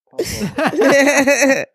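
high, loud stretched call; exasperated call. Real, not acted. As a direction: holler his name, fed up. Calling out, exasperated.

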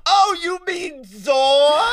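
high, slow drawn-out realisation; mock surprise. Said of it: "And she goes".

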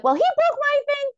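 high, whiny defensive squeak; whiny kid voice. Retold or imitated. Kid defending herself to mother, high whiny squeak. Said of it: "I'm like".

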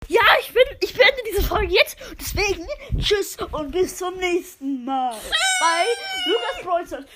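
loud, high channel sign-off; YouTuber outro. Acted. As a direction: shout the goodbye like a YouTuber. Kid closes episode loudly.

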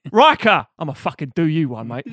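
loud mock cockney; cockney accent. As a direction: shout it like a cockney. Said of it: "cockney as fuck".

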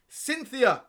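name cried out high and loud; mock-impassioned slam poet's outcry; dramatic falling cry on the name; slam poetry. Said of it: "I'm gonna perform this poem".

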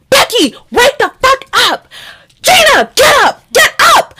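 very high, furious retold shouting; banging-on-the-door holler; angry wake-up yell. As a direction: yell through a door to rouse housemates. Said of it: "I get so angry".

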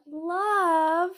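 one long, high, stretched-out patronising word; mock condescending. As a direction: imitate someone talking down, dragging it out. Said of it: "in a condescending way".

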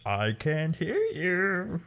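high, drawn-out cartoonish man-child voice; Pee-wee Herman. Retelling the magic shop gag to a friend, Pee-wee Herman impression. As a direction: pitch it way up and stretch each word slowly. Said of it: "And it's, like, Pee Wee Herman voice".

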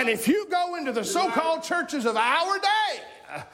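high, driving preacher voice; impassioned pulpit delivery; fired-up preaching. Real, not acted. Preaches at full force.

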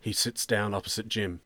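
ordinary delivery

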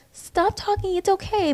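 soft, high, calm telling-off; a soft, sweet grandmother hushing the child; calm gentle hush. Retold or imitated. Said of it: "calmly tell her".

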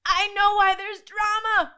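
imitating best friend. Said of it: "she's like".

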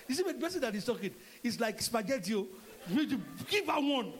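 usual speaking voice

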